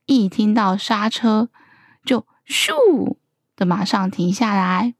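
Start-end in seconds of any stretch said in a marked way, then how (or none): 2.5-3.2 high, falling whoosh; storyteller's gliding whoosh sound effect; imitated whoosh. A narrator's reading. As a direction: swoosh like a sudden stop. Whoosh of sudden braking.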